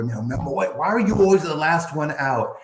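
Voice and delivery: little brother. Retold or imitated. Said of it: "my brother".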